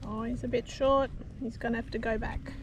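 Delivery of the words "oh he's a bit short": usual speaking voice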